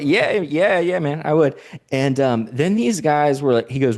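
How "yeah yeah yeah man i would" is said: higher-pitched eager going-along; acted hasty agreement. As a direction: agree eagerly to placate a stranger. Acts his nervous agreeing reply.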